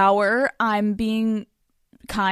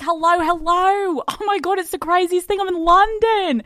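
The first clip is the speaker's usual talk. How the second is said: high, bright put-on voice; fake breezy excuse; 9 semitones higher than usual. Dodging the therapist with a high, breezy put-on voice. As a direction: chirp the excuse up high, playing it cool. Pretending to be abroad.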